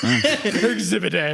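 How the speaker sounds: giggling aside; laughing. Real, not acted. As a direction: tease them while laughing. Joking about podcasters present.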